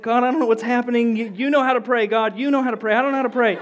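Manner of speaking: high, helpless pleading; hammed-up panicky prayer; flustered prayer. Retold prayer to God beside the man, high and flustered.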